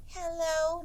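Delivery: high kiddie phone hello; thirteen-year-old voice. Retold or imitated. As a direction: answer in a put-on child voice. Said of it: "thirteen-year-old voice".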